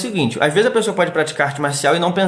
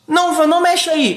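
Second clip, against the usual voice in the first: scolding adult; 11 semitones higher than usual. Retold or imitated. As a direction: imitate a fussy adult telling a kid off. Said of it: "as pessoas falam assim".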